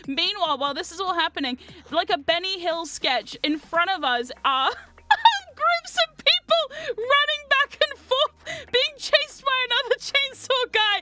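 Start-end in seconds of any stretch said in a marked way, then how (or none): 1.9-11.0 high, loudening words squeezed through laughter; cracking up. Real, not acted. Storyteller describing a slapstick chase, helpless with laughter. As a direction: lose it laughing while describing it.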